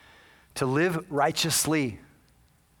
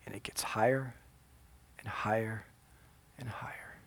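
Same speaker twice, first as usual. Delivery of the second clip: low voice fading toward a whisper; solemn, dwindling storytelling; hushed grave narration; 7 semitones lower, 10 dB quieter than usual. A narrator's reading. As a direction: let each phrase sink quieter, with pauses.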